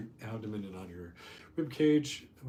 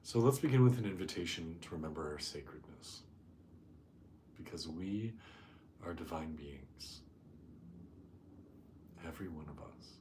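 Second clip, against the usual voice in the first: soft, hushed meditation voice with long pauses; guided meditation; 2 semitones lower, 9 dB quieter than usual. Real, not acted. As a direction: settle the group gently, speaking softly and unhurried. Leads a meditation.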